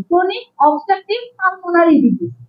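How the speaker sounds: chronic obstructive pulmonary disease spoken very slowly, word by word; spelling out the term slowly for students; slow dictation. Shown as a demonstration. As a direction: dictate the full name slowly for notes. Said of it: "লিখে রাখেন".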